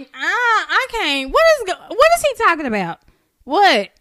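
high, loud baffled outburst; retold exasperation. Co-host reacting to the rambling interview, loudly baffled. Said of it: "And I was like".

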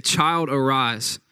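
slow, drawn-out call to rise; reading Jesus' call, slow; slow command. Retold or imitated. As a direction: draw each word out tenderly.

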